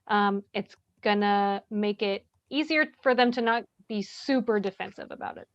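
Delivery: usual speaking voice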